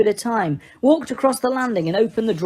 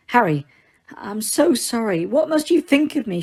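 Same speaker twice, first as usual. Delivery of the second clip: shakily; 4 semitones higher than usual. A narrator's reading. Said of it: "she said shakily".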